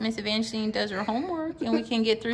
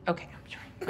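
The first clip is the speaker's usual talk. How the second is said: whispered apology; 5 semitones lower, 10 dB quieter than usual. Real, not acted. Mother apologizing quietly.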